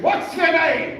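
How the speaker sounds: loud, high Irish demand; booming Irish rabbi. Retold or imitated. Rabbi greeting a nervous new student, booming Irish voice. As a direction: bark the question like a frightening teacher. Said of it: "he was an Irishman".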